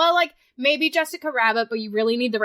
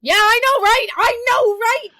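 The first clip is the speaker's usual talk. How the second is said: excited; 12 semitones higher, 7 dB louder than usual. Real, not acted. Excited agreeing shout.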